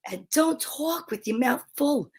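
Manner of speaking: a touch of New Jersey accent on "don't talk"; a light New Jersey lilt. Shown as a demonstration. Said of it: "That was so Jersey of me".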